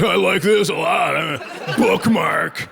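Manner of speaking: put-on dopey approval, slowing at the end; goofy caricature of an ogling fan; mock entranced viewer. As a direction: imitate a mesmerized scroller, drawing out the last word. Imitates viewers enjoying lewd video.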